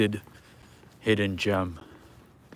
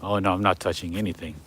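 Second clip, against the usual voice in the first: ordinary delivery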